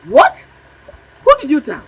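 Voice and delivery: loud, high-pitched angry demand; furious mother interrogating her child; angry confrontation. Acted. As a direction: burst out in outraged questioning.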